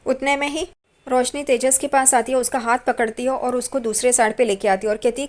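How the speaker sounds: normal talking voice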